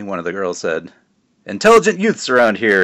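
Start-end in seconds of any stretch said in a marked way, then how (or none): 1.4-2.8 higher, louder showy call; shout. Acted. Father shouting to embarrass Fort in public. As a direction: shout it out to the crowd. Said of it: "his father shouted in response".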